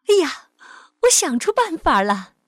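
high, loud cry of discovery; excited exclamation. A narrator's reading. Mother suddenly finds a solution, excited shout. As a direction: call out as an idea strikes. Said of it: "妈妈大叫".

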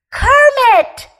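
high, loud yell of the name. A narrator's reading. Scared little bear yelling to wake his friend. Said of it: "Fuzzy yelled".

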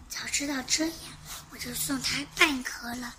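小声嘟囔; said quietly. A narrator's reading. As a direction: mutter it so nobody hears. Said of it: "奇巧先生小声地说".

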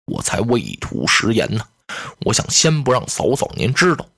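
low, measured general's voice, slowing at the end; a dignified general speaking gravely; low, weighty heroic general; Guan Yu's voice. Acted. Guan Yu explains to the sisters-in-law.

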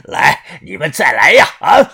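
狂笑间大声挑衅; 疯了似的喊人再来; 疯狂叫嚣. A narrator's reading. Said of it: "他疯狂的大笑". (癫狂地高喊挑衅)

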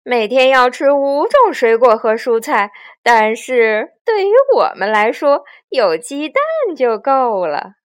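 loud, mocking villain recital; amused bandit chief; 强盗巴巴. A narrator's reading. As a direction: recite it sarcastically, then gloat. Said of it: "强盗巴巴笑道".

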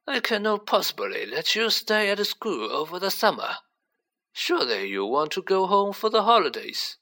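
put-on high-pitched elderly headmaster, gently refusing; kindly old headmaster. A narrator's reading. Headmaster refusing a pupil's summer stay, kindly. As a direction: play a kindly old schoolmaster, pitched well up.

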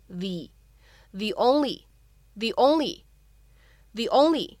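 "the" said as "thee" before "only"; strong-form THE. Shown as a demonstration. Teacher shows learners the stressed article, "the" as "thee". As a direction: say "the" with a long ee, gliding into "only". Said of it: "定冠词应该读成重读的发音".

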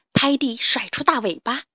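ordinary delivery, nothing marked

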